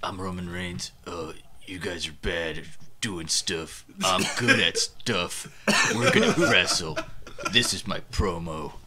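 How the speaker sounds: low, quiet, dull wrestler promo; lightly mocking a bored wrestler; Roman Reigns impression. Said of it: "That's what he sounds like".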